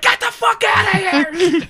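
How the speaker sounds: drawn-out mock shout at the intruder; mock yell. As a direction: bellow it like a fed-up soldier. Soldiers chasing man away.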